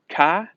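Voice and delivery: "car" said "cah", R dropped; New England accent. Retold or imitated. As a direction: imitate a New Englander saying "car" without the R. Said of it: "they couldn't pronounce the R's".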